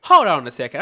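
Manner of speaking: a sudden loud shout to stop everything. Real, not acted. Stopping the viewers to figure out a word, loud.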